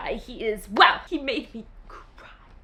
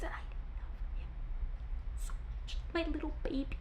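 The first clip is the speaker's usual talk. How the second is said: 1 whispered confession; 3 semitones higher, 16 dB quieter, faster than usual. Real, not acted. Gushing over a book boyfriend.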